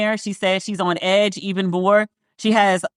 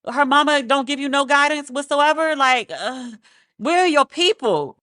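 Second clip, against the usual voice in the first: higher, incredulous questioning; a reviewer mock-interrogating the cousin, incredulous; 5 semitones higher than usual. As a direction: grill her like you can't believe it. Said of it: "Which I was like".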